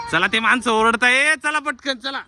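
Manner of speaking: high, pushing hurry-up call. Real, not acted. Urging kids to hurry out.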